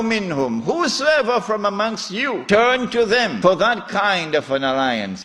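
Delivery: high-pitched, ringing scripture proclamation; forceful scripture recitation. Acted. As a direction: recite the verse high, then declaim its meaning.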